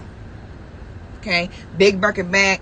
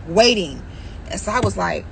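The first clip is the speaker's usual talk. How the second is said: ordinary talk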